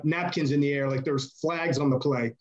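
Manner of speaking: normal talking voice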